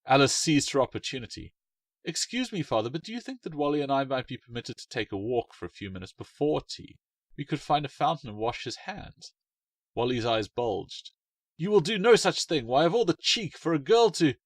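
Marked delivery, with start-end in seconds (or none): 2.0-9.3 put-on higher, sweetly polite girl's voice; a girl politely asking her father; polite young girl. Alice's polite request. 11.6-14.3 loud, high indignant outburst; little brother protesting loudly; outraged protest. Acted.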